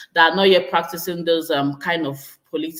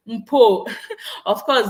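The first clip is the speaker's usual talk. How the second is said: laughing; 5 semitones higher, 5 dB louder, slower than usual. Real, not acted. Laughing at the accent comment.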